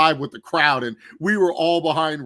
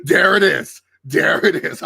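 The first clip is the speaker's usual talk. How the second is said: loud, higher imitated exclamation, said twice; gleeful callout; 4 semitones higher, 8 dB louder than usual. Host repeating a wrestler's quoted line, loud and gleeful. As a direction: crow it like a gotcha.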